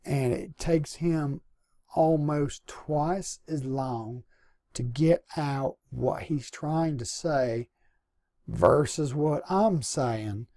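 very slow, drawn-out Southern delivery; imitating a friend's unhurried Southern pace; slow Southern talker. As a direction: stretch the words out like a slow-talking Southerner. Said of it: "he talks real slow".

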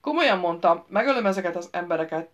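loud threatening bellow; shouted threat at the knight outside. A narrator's reading. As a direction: roar the threat through the door. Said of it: "ordította Royce".

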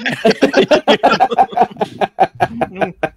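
a loud high laugh that falls away; real laugh, pitched high and falling off; high burst of laughter. Real, not acted. (หัวเราะเสียงสูงดังแล้วค่อยๆ ลดลง)

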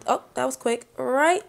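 ordinary delivery

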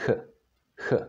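"h" as a throaty fricative, like German ach; Czech CH sound. Shown as a demonstration. Teacher explains C H together, "h" as Czech CH. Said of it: "are always pronounced h".

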